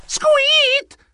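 a high, piercing mouse squeak of fright; terrified squeak. Acted. Mouse jolted awake by intruders, shrill squeak.

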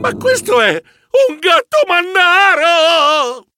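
high-pitched, drawn-out shriek of alarm; theatrical horrified scream; panicked cry. Acted. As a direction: cry out in terror, stretching the last word. Terrified at the monstrous meow.

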